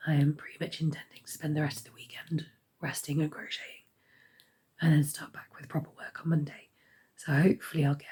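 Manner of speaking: hoarse whisper. Real, not acted. Sick vlogger with failing voice.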